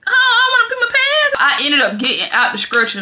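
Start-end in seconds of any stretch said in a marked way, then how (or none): 0.1-1.4 squeaky high, fast panicky plea; panicked. Retold or imitated. Pregnant patient fearing she'll wet herself, high panic. Desperate not to wet pants.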